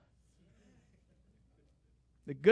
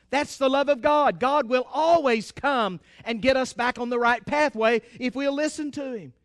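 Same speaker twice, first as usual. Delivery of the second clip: ordinary delivery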